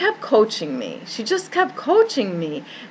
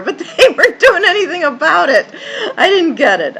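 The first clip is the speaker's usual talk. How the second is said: laughing through tears; 8 semitones higher, 6 dB louder, faster than usual. Real, not acted. Recalling doctors doing nothing.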